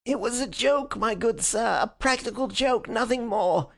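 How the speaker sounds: high pleading whine; nasal whine. Acted. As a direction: whine it like a cornered villain. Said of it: "he whined incessantly".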